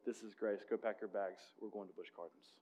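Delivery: tender father. Retold or imitated. Father grants undeserved trip.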